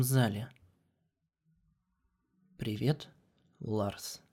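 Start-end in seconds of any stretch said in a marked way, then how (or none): 2.6-4.2 soft, low, calm princess; calm Celestia voice. A narrator's reading. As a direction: speak gently as the AI princess.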